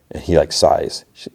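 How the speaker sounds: plain reading voice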